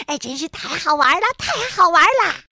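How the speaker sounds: fast, loud, out of breath from play; breathless delight. A narrator's reading. Frog out of breath after the snow fight, panting glee. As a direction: pant it out with glee. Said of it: "上气不接下气地说".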